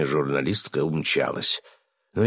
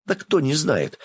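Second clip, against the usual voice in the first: high, eager chatter; rattling on excitedly; 10 semitones higher than usual. Acted. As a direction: gush it out in a rush. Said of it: "затараторила девушка".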